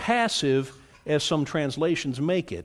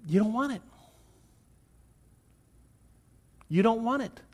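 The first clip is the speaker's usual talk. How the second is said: grieved lament; 8 semitones higher, faster than usual. Acted. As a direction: let the plea break into sorrow. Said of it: "Jesus is saying".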